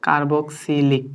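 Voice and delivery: Carboxylic drawn out slowly, part by part; teacher slowly sounding out carboxylic; slow dictation. Shown as a demonstration. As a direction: stretch each syllable of carboxylic for a student to repeat. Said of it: "কি বলো তো?".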